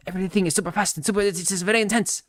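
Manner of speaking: high, rushed mock-intense actor voice; Diego Luna. Streamer mocking how an actor talks, rushed and intense. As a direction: imitate an actor forcing intensity, high and fast. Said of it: "that's just the way that Diego Luna talks".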